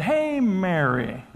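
drawn-out Southern drawl; Southern accent. Retold or imitated. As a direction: stretch the greeting in a slow Southern accent. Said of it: "I start talking in a Southern accent".

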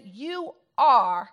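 YOU ARE drawn out with weight; emphatic. Retold or imitated. Preacher quoting Jesus to the congregation, emphatic. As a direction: land firmly on each word, "You are". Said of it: "are with emphasis".

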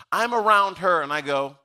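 ordinary talk, nothing marked

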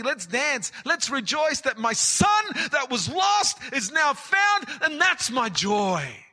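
high, loud, rising to a joyful shout; rejoicing father. Acted. Father telling his returned son to rejoice, loud and exultant. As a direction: celebrate like a father throwing a party.

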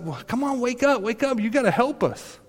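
high, pleading cry to wake him; urgent plea. Retold or imitated. Disciples shaking Jesus awake in the storm, high frantic plea.

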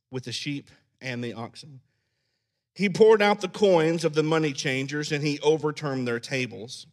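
quiet, measured Bible reading; hushed scripture reading. Acted. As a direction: read the passage softly and gravely. Reads scripture passage aloud.

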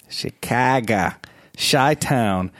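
put-on Chicago accent, "Chicago" drawn out as shi-KAA-guh; playful Chicago accent. Host teasing listeners' home city, mock Chicago accent.

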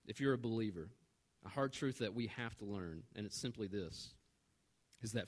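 soft, low, measured preaching with long pauses; hushed, grave sincerity; solemn. Real, not acted. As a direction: bring it right down and let the hard truth sink in. Sermon turns solemn after the comic story.

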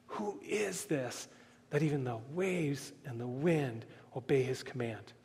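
hushed, low wonder; quiet, awestruck questioning; fearful awe. Retold or imitated. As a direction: voice the disciples' awe very softly. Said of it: "there was also a fear".

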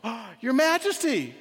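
high, loud startled gasp of greeting. Acted. Artist looks up to find the king, startled. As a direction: look up startled, voice jumping high.

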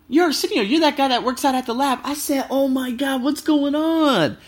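high-pitched excited imitation of a chance meeting; retold surprised recognition.

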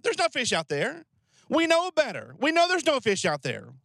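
high-pitched objection, mildly exasperated; mock protest. Disciples objecting when told to cast the net, mock exasperation. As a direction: push back as a doubting know-better.

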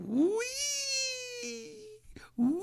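long, very high "wee"; imitated high-pitched whee; gleeful flying squeal. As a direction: squeal like someone sailing through the air. Imitates her flying through air.

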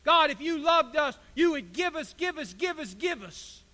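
high, insistent mock demand, tapering off; demanding whine. People complaining to God for gifts, mock demanding. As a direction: start loud and high, chant the demand repetitively.

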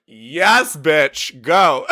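high, loud hype cheer; cheering her on. Acted. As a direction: hype up a friend with punchy delight. Imagined supporters cheering her on.

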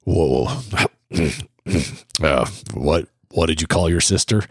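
a hint of a dad about to scold; father questioning his son, mildly stern and low. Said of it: "and he's real stern".

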